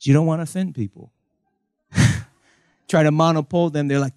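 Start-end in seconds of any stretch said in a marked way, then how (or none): none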